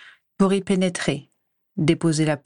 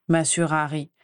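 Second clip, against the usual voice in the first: usual speaking voice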